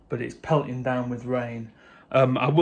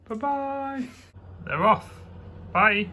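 singsong goodbye; 9 semitones higher, slower than usual. Real, not acted. Singsong farewell.

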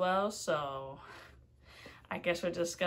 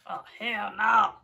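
louder, full-voiced hell no; loud refusal; 7 dB louder than usual. Real, not acted. Vlogger reacting to the warning, loud refusal. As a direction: say it out loud this time.

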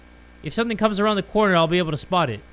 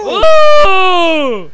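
sudden high loud cry; startled shriek; 10 semitones higher, 12 dB louder than usual. Real, not acted. Streamer startled by a figure in the dark, loud shriek. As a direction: shriek in fright.

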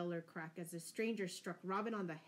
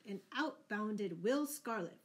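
plain reading voice

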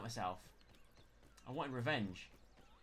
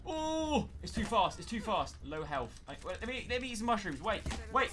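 high, loud breathless yelps; frantic panic; 10 semitones higher, 9 dB louder than usual. Real, not acted. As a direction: scramble and shout while fleeing. Fleeing fast enemy, low health.

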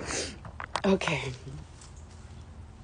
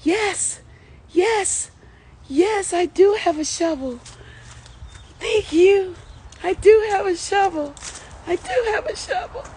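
high-pitched, worked-up wail; real upset, voice way up high; tearful distress; 12 semitones higher than usual. Real, not acted. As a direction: cry it out high, helplessly. Tearful realisation she has shovel.

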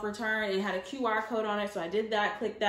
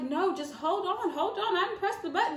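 high, laughing, urgent hold on; urgent pleading; 7 semitones higher than usual. Retold or imitated. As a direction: stop the driver from turning around. Stopping driver from turning around.

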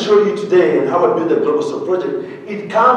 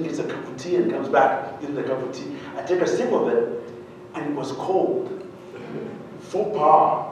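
usual speaking voice

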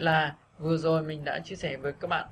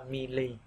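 "mì lì" low and falling; unstressed falling tone; 4 semitones lower, 5 dB quieter than usual. Shown as a demonstration. Teacher showing the unstressed syllables, "mì lì" low and falling. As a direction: let "mì lì" drop as unstressed syllables. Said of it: "đọc thêm dấu huyền".

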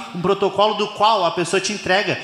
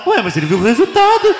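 slightly higher, louder puzzled objection; confused audience member; 4 semitones higher, 5 dB louder than usual. Acted. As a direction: play a baffled Ricardinho in the crowd. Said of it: "tem uns Ricardinho na plateia".

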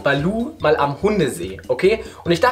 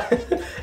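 stifled giggles; 7 semitones higher, 6 dB quieter than usual. Real, not acted. Stifled laughter continues.

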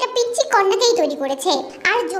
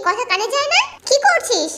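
usual speaking voice

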